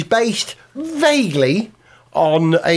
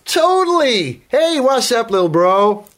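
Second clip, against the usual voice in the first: put-on high, cheesy American teen; hammed-up Californian dude; American accent; 5 semitones higher than usual. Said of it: "You're not American".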